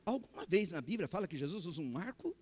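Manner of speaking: ordinary talk, nothing marked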